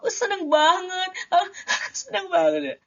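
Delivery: high squeal of delight with a laugh; overjoyed demo. Shown as a demonstration. Coach acting out winning an umrah voucher, overjoyed. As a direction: act thrilled to bits, voice leaping up. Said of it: "Kalau lagi happy nih, dapet voucher umroh".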